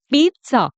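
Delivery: पिज़्ज़ा said "PEET-sa"; teacher's model पिज़्ज़ा, "peet-sah"; correct "pizza" with ts. Shown as a demonstration. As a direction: put a crisp t-s in पिज़्ज़ा, "pitsa". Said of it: "जो सही तरीका है इसको बोलने का".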